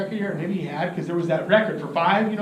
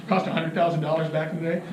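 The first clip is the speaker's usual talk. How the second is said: usual speaking voice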